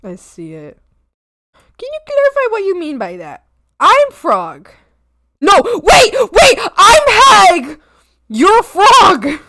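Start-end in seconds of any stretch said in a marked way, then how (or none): 5.4-9.4 high, loud, slow insistence; playful yelled protest; mock indignant shout. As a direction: shout it like a furious correction. Frog/hag argument escalates.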